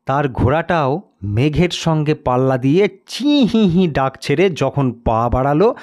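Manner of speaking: ordinary delivery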